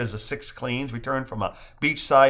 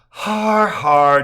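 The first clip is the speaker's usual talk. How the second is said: sarcastic har har; 7 semitones higher, 10 dB louder than usual. Acted. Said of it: "Julie replies, shaking her head".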